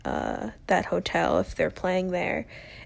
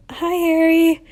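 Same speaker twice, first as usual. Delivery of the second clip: higher, louder starstruck hello; excited fan greeting; 9 semitones higher, 8 dB louder than usual. Retold or imitated. Fan greeting [name].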